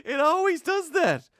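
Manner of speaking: very high, loud exasperated squeal; voice getting high. Real, not acted. Streamer failing at Canadian, squeaky mock-angry protest. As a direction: let the voice shoot up, helpless with laughing frustration. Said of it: "Why is my voice getting so high?".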